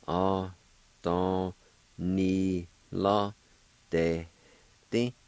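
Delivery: Adonilodete stretched out very slowly; very slow model word. Shown as a demonstration. Teacher slowly modelling the word for learners. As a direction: drag out Adonilodete syllable by syllable.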